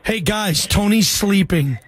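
ordinary talk

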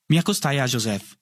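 ordinary delivery, nothing marked

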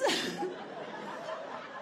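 airy silent wheeze of laughter; helpless breathy laugh; breathless laugh. Real, not acted. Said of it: "scream laughing".

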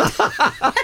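high breathless giggle; laughs. Real, not acted. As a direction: burst into a wheezy laugh. Laughing at daughter's remarks.

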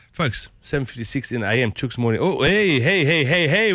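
ordinary talk

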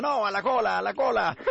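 mock indignant crowd shout; line-jumper rebuke. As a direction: call out at someone cutting in. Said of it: "se quiere meter otra vez a la fila y dice".

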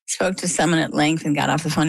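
normal talking voice

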